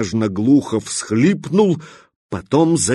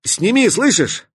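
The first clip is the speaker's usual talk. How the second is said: a high commanding shout; 10 semitones higher than usual. Acted. Katya orders the stalker to drop the pack, shouting. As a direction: order him to take it off.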